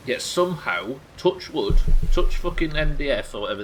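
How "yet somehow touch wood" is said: ordinary talk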